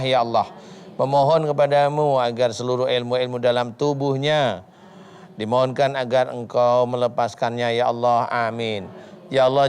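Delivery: measured, rhythmic Arabic recitation then fervent pleading; earnest prayer. Real, not acted. Ustadz praying over the troubled mother, earnest intoned prayer.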